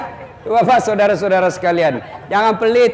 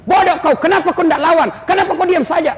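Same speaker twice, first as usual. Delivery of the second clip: scolding wife; 7 semitones higher than usual. Retold or imitated. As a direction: scold him like a fed-up wife. Wife scolding husband at home.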